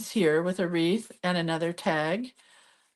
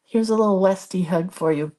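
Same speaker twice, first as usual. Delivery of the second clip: normal talking voice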